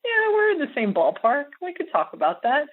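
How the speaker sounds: high, breezy fake confidence; playing it off. Caught lowballing on a phone interview, playing it off high. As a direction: brush it off like the number was fine. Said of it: "I played it off like".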